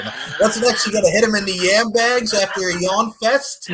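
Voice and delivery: high, loud laughing taunt; laughing tease. Real, not acted. Teasing cohost Tommy about a yawn fest, laughing. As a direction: needle your buddy through laughter.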